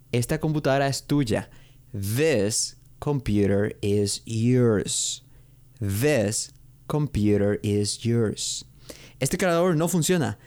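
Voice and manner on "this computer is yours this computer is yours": very slow, word-by-word teaching delivery; exaggerated model pronunciation. Shown as a demonstration. Teacher giving learners an example sentence, slow exaggerated diction. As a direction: space out every word and lean on the th. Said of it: "pueden exagerar un poco para que".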